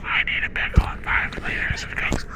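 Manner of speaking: soft unvoiced order; whisper. Customer whispering the order after being asked to be quiet, soft and unvoiced. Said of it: "could you whisper?".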